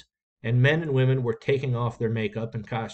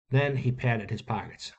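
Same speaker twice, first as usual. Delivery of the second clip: plain reading voice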